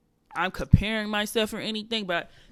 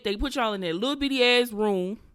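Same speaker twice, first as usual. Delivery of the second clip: ordinary delivery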